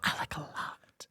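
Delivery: slow sultry whisper. Pretend. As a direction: linger on each word, whispering. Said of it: "Give us a little taste".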